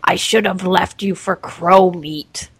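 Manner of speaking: slow, lightly put-on deep voice; half-hearted impression of a gruff pirate king; Cage's deep voice. Podcaster quoting a favourite movie line, slow mock-deep voice. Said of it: "but in that deep voice".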